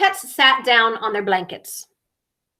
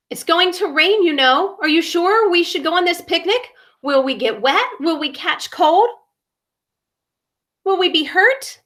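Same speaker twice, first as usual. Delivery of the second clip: fearful frog; 5 semitones higher than usual. Acted.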